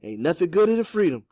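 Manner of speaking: plain reading voice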